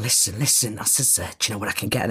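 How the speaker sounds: low, hushed aside; furtive approach. Retold or imitated. Asking a stranger about scoring drugs, hushed and furtive. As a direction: quietly sound someone out, pitched low.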